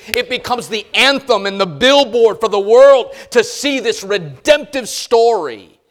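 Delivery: high, loud preaching; full-force preaching. Acted. Pastor urging the congregation on marriage, at full preaching force.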